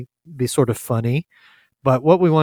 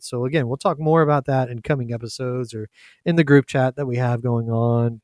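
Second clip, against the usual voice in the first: ordinary talk, nothing marked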